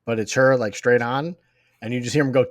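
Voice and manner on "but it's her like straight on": normal talking voice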